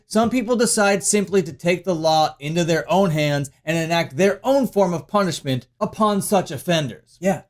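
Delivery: usual speaking voice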